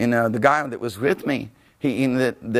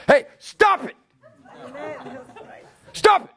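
commanding shout; 14 semitones higher than usual. Retold or imitated. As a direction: order them to stop. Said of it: "authority came out of my mouth".

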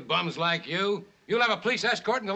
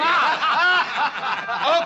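mocking laugh; 8 semitones higher, 5 dB louder than usual. Commissioner mocking the story.

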